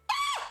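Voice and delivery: very high short shriek; imitated scream. Imitating startled girl fleeing him, high scream. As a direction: shriek in sudden fright. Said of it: "and she goes".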